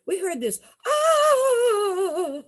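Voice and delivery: high mock wail "ah"; imitated scream. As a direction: mimic the scream for the storekeeper. Cousins imitate scream to storekeeper.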